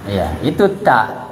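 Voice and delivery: ordinary delivery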